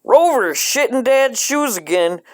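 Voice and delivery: put-on alarmed kid tattling; excited alarm. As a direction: blurt it out in alarm.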